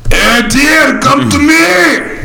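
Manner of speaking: high, slow shouted mock Russian hunter; mock Russian accent. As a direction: bellow at the deer in a hammy Russian accent. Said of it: "Your Russian dude sounded like an angry French guy".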